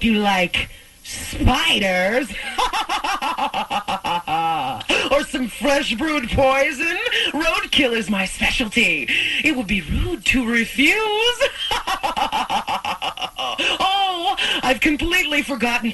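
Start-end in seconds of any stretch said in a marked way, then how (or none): 2.4-5.1 sinister rising ghost laugh; evil cackle. Acted. Spirit cackling at guests over spider dinner, evil laugh. 11.5-13.4 sinister rising ghost laugh; evil cackle. Acted. Spirit cackling at guests over spider dinner, evil laugh.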